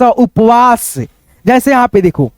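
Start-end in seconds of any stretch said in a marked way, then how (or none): none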